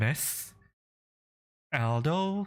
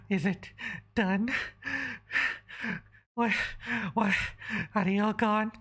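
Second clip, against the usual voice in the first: panting; 8 semitones higher than usual. Acted. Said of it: "she's panting and going".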